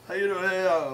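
lightly imitated incomprehensible Scottish drunk; mock drunk Scot. Drunk Scot shoves over a beer, lightly garbled. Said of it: "could not understand a fucking word".

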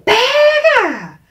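very high, long mock shout; crowd yelling. Retold bystander cheering on the chase, high drawn-out yell. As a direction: holler like onlookers at a chase. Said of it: "todo mundo começou a gritar".

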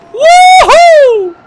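very high, long, loud woohoo; excited whoop. Real, not acted. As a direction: let out a soaring whoop. Jumping in game. (tiz, uzun sevinç çığlığı)

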